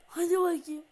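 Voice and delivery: loud, pushing cheer; loud urging. Real, not acted. Gamer loudly urging his player on. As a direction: shout it to spur the player.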